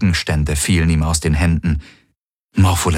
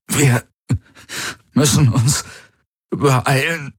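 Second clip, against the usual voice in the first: high, halting, strained gasps; battle for every word; fighting for words; 8 semitones higher, slower than usual. A narrator's reading. Cramping Garland urging them to hurry, gasping. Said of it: "als würde er um jedes Wort kämpfen".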